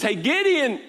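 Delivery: high, emphatic divine proclamation; God calling Gideon. Acted. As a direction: proclaim it as God rallying a doubter. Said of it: "God comes on the scene and says".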